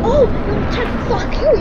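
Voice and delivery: loud, high, mock-outraged yell; mock bigot yell. Gamer mocking bigots, loud outraged yell.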